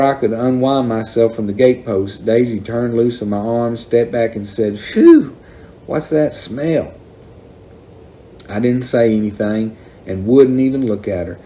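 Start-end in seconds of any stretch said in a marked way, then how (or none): 4.8-6.9 high, loud phew, then slower question; recoiling disgust. A narrator's reading. Daisy stepping back from her brother, recoiling at smell. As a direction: exclaim at a bad smell, high-pitched. Sister smells whiskey on him.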